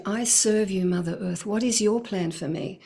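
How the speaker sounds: ordinary delivery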